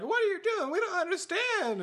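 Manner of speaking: high, hammed-up bewilderment; mock distressed protest. Captives question Hank as he monologues, mock distressed.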